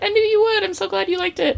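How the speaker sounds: very high, gushing imitation of an actress; delighted star. Actress hearing the fan loved her film, delighted. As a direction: squeal it sweetly, imitating a thrilled star.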